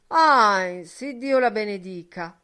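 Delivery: loud, happy shout of relief; glad cry. A narrator's reading. Buck Mulligan crying out in relief to Heinz, loud and glad. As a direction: cry out the blessing with joy. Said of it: "gridò Buck Mulligan".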